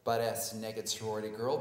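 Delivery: ordinary talk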